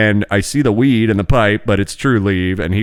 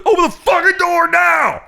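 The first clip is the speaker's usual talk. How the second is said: much higher, louder barked command; cop shouting order; 3 dB louder than usual. Retold or imitated. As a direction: bellow it like a cop at the door. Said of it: "so he goes".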